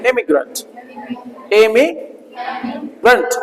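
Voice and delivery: Immigrant drawn out very slowly, every sound clear; slow model pronunciation. Shown as a demonstration. Teacher models the word for students to repeat, slowly. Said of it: "बोलो".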